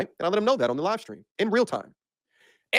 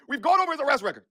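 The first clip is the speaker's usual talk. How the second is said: ordinary talk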